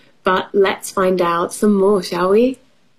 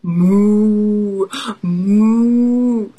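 long, drawn-out moos; sustained storybook mooing; cow moo. Acted. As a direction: imitate a cow for young children. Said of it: "said cow".

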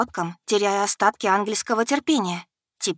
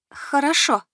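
hoarse; 7 semitones higher, slower than usual. A narrator's reading. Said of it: "вымолвила сипло".

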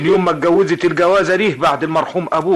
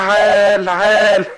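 higher, louder approving cry; pleased exclamation; 7 semitones higher, 5 dB louder, much slower than usual. Acted. Uncle hearing his niece's news, pleased and loud.

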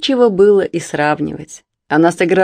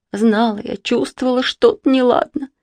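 rising higher in alarm, getting worked up; a worried mother whose voice climbs higher; agitated; 7 semitones higher than usual. A narrator's reading. Mother to the girls, voice climbing in alarm. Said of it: "взволнованно заговорила она".

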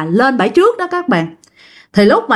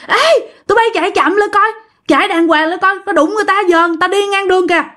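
calling out; 8 semitones higher, faster than usual. Retold or imitated. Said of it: "bạn mới la làng lên mà nói rằng".

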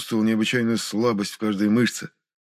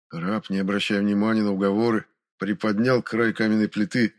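usual speaking voice

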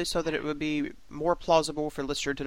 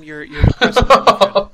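laughing; 4 semitones higher, 14 dB louder than usual. Real, not acted. Joking after host's gloomy line.

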